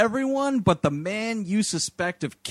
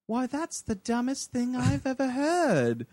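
higher, mock-offended nanny act; put-on nanny voice; 5 semitones higher than usual. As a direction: play the nanny feigning disbelief. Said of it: "they bring the nanny in".

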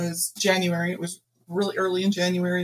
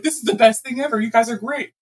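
delighted; 3 semitones higher, 7 dB louder, faster than usual. Retold or imitated. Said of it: "he thought".

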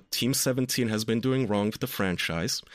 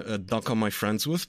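usual speaking voice

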